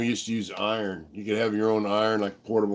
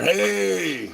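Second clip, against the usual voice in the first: long, high-pitched mock holler; imitated catcalling yell; 9 semitones higher than usual. Guys in the hole yelling at her, imitated catcall. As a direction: draw it out high, imitating men yelling across a yard. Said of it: "They're yelling at her".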